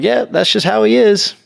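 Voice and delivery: high, breezy mock dismissal; retold nonchalance. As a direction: shrug it off like an unbothered parent. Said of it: "being real nonchalant".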